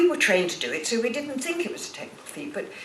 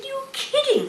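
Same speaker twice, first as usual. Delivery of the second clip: much higher, laughing "You're kidding"; retold disbelief. Answering the fan on the phone, laughing amazed. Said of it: "I said".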